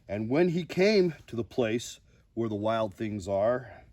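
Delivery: lively storybook reading with big rises; reading to children. Acted. Host reading a picture book to viewers, expressive storybook voice. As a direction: read the bedtime book aloud with drama.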